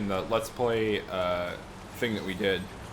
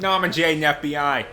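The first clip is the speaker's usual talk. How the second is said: put-on loud Boston accent on the name; 4 semitones higher, 5 dB louder than usual. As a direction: say Norman Jaden the Boston way. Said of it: "only got a Boston accent".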